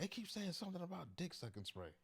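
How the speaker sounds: very high mock mother voice; imitating clueless mom. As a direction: deliver it as his innocent mom, pitch way up. Punchline in mom's voice.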